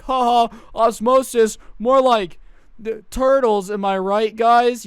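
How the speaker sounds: a smug kid cracking a bad joke, loud and slow; obnoxious class clown. Kid heckles the teacher with a joke, loud and goofy.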